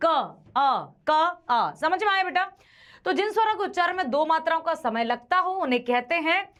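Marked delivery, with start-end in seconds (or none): 0.1-1.7 क and अ said apart, slow and clear; sounding out letters. Shown as a demonstration. Teacher splitting a letter into sounds for students, deliberate. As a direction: break क into its sounds, क then अ. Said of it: "तो क sound आता ही नहीं".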